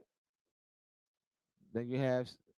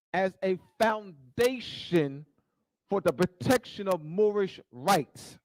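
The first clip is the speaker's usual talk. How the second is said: louder, slower, hammering each phrase; fervent preaching; 5 semitones higher, 7 dB louder, slower than usual. Acted. Preacher repeats his charge to the crowd, fervent and louder. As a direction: drive it home harder the second time.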